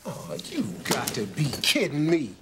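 ordinary talk

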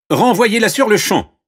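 high, loud command; princess giving orders. Acted. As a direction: dismiss someone as an imperious princess. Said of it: "déclara la princesse". (ordre aigu et fort de princesse)

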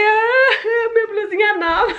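high, half-laughing fake whimper; playful mock sob; mock crying. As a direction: ham up the heartbreak over a ruined blouse. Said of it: "saí quase chorando".

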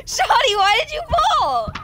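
high, loud mock-crying wail; wailed complaint. Player asks partner why they fell, wailing in protest. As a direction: cry out in playful dismay.

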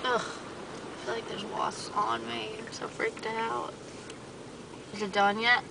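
usual speaking voice

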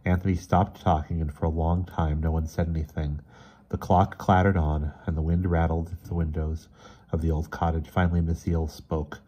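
ordinary delivery

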